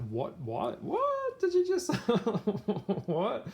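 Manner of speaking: high squeaky disbelieving what, breaking into a laugh; baffled what. Retold or imitated. Reacting to Luna's strange remark, stunned and high. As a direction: play it as total bewilderment.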